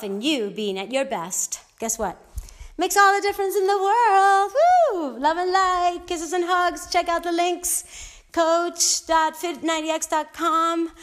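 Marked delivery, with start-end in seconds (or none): 2.8-4.5 higher and louder, pumped; excited. Real, not acted. Closing pep line. 4.6-5.2 high, loud whoop; excited woo. Real, not acted. Closing cheer. 5.2-10.9 excited. Real, not acted. Upbeat farewell.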